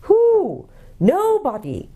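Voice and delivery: very high hoot, then falling; hooting owl. A narrator's reading. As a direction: let it ring out as an owl call. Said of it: "hooted the owl".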